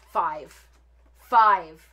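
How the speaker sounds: FIVE as a loud, falling one-word sentence; "Five" punched out in disbelief; indignant emphasis. Real, not acted. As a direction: hit "Five" hard, then harder, with a pause between. Indignant at price for five pieces.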